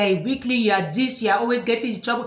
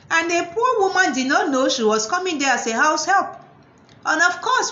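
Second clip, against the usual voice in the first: ordinary delivery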